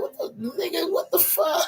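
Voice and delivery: high, cracking cry of disbelief; shocked disbelief. Retold or imitated. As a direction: squeal it out, stunned. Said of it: "I was like".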